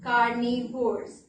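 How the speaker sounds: carnivores. drawn out slowly for the class; slow key word. Shown as a demonstration. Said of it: "the animals are called".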